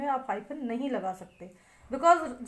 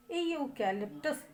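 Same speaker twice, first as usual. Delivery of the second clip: Eucalyptus slowly, leaning on ee-yoo, ka and lip; three syllable stresses; 3 semitones higher, 4 dB quieter, slower than usual. Shown as a demonstration. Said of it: "तीन stress आ रहे हैं".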